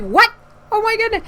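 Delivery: very high retold astonishment; startled surprise. Reading cousin's text saying they're here, high startled surprise. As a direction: squeal it in mock shock.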